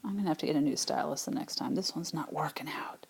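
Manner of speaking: hushed, fast aside to herself; whispered aside. Real, not acted. Teacher grumbling to herself about the stylus, whispered. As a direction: drop to a whisper over the broken stylus.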